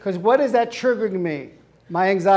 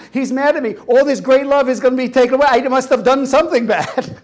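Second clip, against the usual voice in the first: high, loud, fast fretting; mock panic; 6 semitones higher, 7 dB louder than usual. [name] realizing he's upset, hammed panic. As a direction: spiral into guilt.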